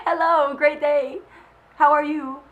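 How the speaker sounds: high, loud, slow sing-song cheer; mocking her friends' chirpy replies; mock cheery greeting. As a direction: ham up an over-bright hello. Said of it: "Everyone was like".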